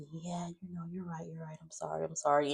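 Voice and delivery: soft, placating apology; barely-there sorry to a scolding mom; backing down quietly to her mother; meek apology. Retold or imitated. Eva apologizing meekly.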